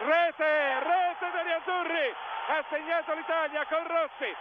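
high, drawn-out goal cry; breathless italian goal commentary. Real, not acted. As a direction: stretch out the goal call, slowly. Radio commentator calls a goal.

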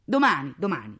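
loud dismissive repeat, dropping in pitch; angry. Acted. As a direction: shut a husband up scornfully. Mother cuts husband off. (interruzione rabbiosa)

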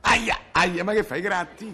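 high yelp of mock pain. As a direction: squeal as if scratched. Yelping at being scratched.

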